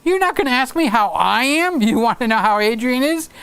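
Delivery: high, mock-offended friend; imitating Lewis. Lewis pretending to be hurt he wasn't asked, mock-offended and high. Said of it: "So Lewis is going".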